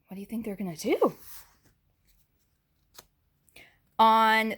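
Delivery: put-on lively read-aloud for little kids; picture-book narration; story reading. A narrator's reading. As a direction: read the book brightly to the class. Reads picture book to class.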